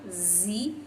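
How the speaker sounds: ordinary delivery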